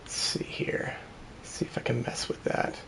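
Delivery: whispered to himself while fiddling; under-the-breath whisper, working something out. Real, not acted. Fiddling with audio settings, muttering.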